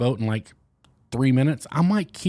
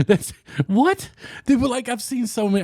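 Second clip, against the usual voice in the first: high-pitched baffled "what"; incredulous; 11 semitones higher than usual. Real, not acted. Vlogger reacts to being told to shut up, incredulous. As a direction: let it jump up high in disbelief. Incredulous at talk-too-much comments.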